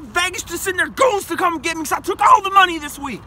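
ordinary talk

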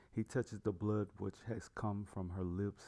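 ordinary delivery, nothing marked

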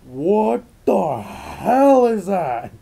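slow, high, full-volume yell; freaked-out yell. Acted. Father reacting to his son's fiery orb, freaked-out yell. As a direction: shout it drawn out in alarm. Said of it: "Marcus freaked out and yelled".

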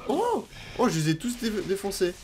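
high, loud thrilled shout; excited cheer. Real, not acted. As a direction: cheer a big win. Thrilled by a big kill.

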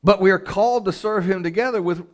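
high, loud preaching; forceful exhortation. Real, not acted.

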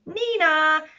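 high, sung-out nee-naw; playful two-note siren noise; imitated police siren. A narrator's reading. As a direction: wail it like a police car. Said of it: "called the cops and they came".

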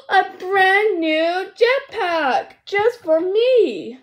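slow, loud, high pretend amazement; awed delight. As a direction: stretch out the thrill of a dream gift. Marshall discovers his gift.

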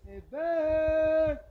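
high, long call to a bear; scaring off a bear. Real, not acted. Calling out to scare bear.

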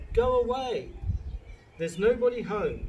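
high, loud pig squeal; scared squeal. A narrator's reading. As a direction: squeal it out like a frightened little pig. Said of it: "he squealed".

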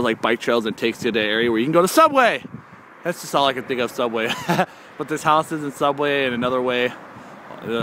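usual speaking voice

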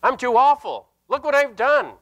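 normal talking voice

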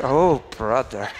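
low, slow judgmental mutter; mock-weary disapproval under the greeting; exasperated aside. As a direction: drop low and groan it in disdain. Muttered judgment after the polite greeting.

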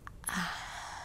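long, breathy exhale of relish; sated sigh. Acted. Vampire after drinking blood, sated sigh. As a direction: let out a satisfied breath.

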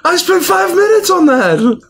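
high-pitched, loud outburst of offence; indignant protest. Acted. Gift-giver to friend who would throw the carving away, indignant.

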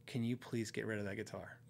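low, soft, serious plea; quietly serious imitation of a wife; dead serious. Wife asks player to drop the guitar, dead serious. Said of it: "it was so serious and so weird".

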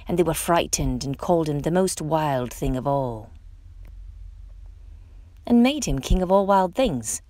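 ordinary delivery, nothing marked

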